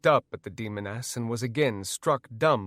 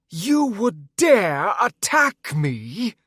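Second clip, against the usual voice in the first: loud, slow, high outrage; angry; 8 semitones higher, 7 dB louder, much slower than usual. Acted. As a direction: spit it out in slow fury. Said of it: "she said angrily".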